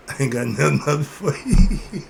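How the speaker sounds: loud, laughing dismissal. Real, not acted. Laughs while turning others away.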